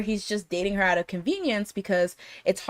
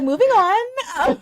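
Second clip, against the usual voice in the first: high, slow, airy brush-off; mock breezy dismissal; 13 semitones higher, 5 dB louder, much slower than usual. Podcaster voicing the girl dodging the awkward moment, high and slow.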